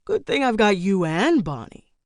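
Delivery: dry sarcastic thanks; put-upon, mock-grateful husband; eye-rolling sarcasm. A narrator's reading. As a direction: say it with an audible eye roll. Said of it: "he said with an eye roll".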